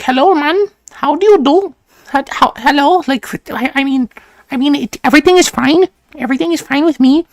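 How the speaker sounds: high-pitched imitation of an Arabic accent; heavy Arabic accent in a high put-on voice. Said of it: "heavy accent".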